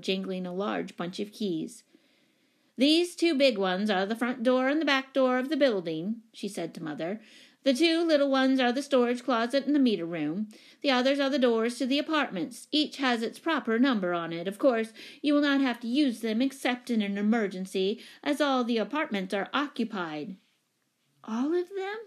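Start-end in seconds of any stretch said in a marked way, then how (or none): 2.8-6.2 loud, raised, declamatory voice; commanding lady. Acted. Commanding tenant explaining keys to new owner, booming. 7.6-20.4 loud, raised, declamatory voice; commanding lady. Acted. Commanding tenant explaining keys to new owner, booming. 21.2-22.0 very high, dismayed question. A narrator's reading. New owner learning every apartment is taken, dismayed. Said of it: "asked Mother in dismay".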